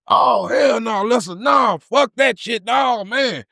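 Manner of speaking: high panicked shouting; panicked outcry. Retold or imitated. Friend screams after morgue call.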